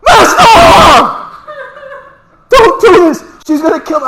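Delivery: screaming very high and very loud, cracking; panicked shout. Retold or imitated. Student yelling down the phone at his master, panicked cracking shriek. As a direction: shriek like a terrified kid whose voice breaks. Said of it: "I swear to God, this loud".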